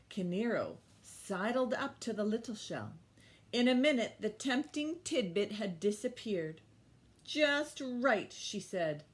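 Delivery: loud, lively read-aloud voice; story reading to children. Acted. Teacher reading The Mermaid to the class, lively storytelling. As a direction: read it big for the little ones. Reads picture book aloud.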